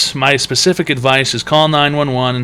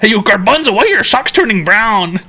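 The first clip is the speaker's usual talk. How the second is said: mocking taunt voice; 8 semitones higher than usual.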